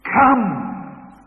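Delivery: high, loud, falling call of "Come."; Savior's ringing invitation. Acted. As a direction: call "Come." out across the hall. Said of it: "says the Savior".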